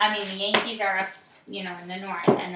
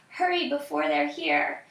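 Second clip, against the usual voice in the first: put-on higher, urgent old woman's voice; 7 semitones higher than usual. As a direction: hurry the children into hiding. Old woman urges children to hide.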